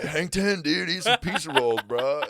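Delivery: high, loud stoner surfer-dude voice for a pizza roll Viking; improvising a goofy surfer-bro Viking in an audition. Retold or imitated. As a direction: play a laid-back California surfer Viking. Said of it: "That's a Southern California Viking".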